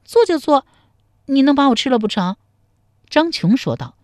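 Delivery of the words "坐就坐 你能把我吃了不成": high, loud coy retort; high-pitched bashful bravado; blushing defiance. A narrator's reading. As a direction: give in with a flustered challenge. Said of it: "陶三春红着脸说".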